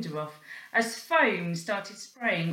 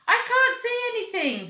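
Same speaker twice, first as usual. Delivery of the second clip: very high, loud cry; distressed cry; 5 dB louder than usual. Acted. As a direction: cry out, blinded. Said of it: "cried the porter".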